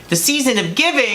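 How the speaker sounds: "The season of giving" lifted high and cheery; bright sing-song cheer. Shown as a demonstration. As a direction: sell "giving" like an ad jingle.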